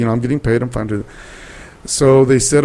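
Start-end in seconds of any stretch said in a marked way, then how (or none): 0.6-1.9 "I'm fine to do that" said slow and much softer, trailing off; quiet aside. Real, not acted. Reassuring the host it was fine, soft slow murmur. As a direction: murmur it to yourself, slowly. Repeats the line, then trails off.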